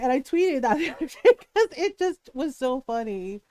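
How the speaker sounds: ordinary talk, nothing marked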